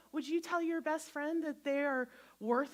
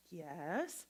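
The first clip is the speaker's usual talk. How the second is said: normal talking voice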